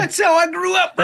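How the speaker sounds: shrill mocking parody of a politician; mocking Gavin Newsom. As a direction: ham up the rich guy claiming hardship. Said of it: "Gavin Newsom's like".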